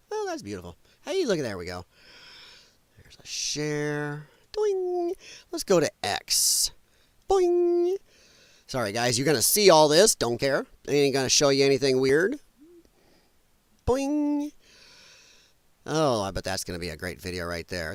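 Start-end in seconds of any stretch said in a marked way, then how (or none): 4.5-5.1 high, playful springy boing; cartoon boing. Voices a click sound effect. 7.3-7.9 high, playful springy boing; cartoon boing. Voices a click sound effect. 13.9-14.5 high, playful springy boing; cartoon boing. Voices a click sound effect.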